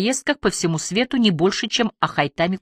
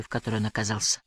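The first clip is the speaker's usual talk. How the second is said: plain reading voice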